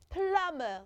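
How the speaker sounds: Plumber said as "plum-er" with no B sound; plumber, silent B. Shown as a demonstration. Teacher shows the children her mouth saying Plumber, no B. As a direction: mouth Plumber clearly, B left out. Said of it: "Did you see my mouth?".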